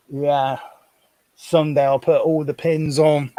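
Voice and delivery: ordinary delivery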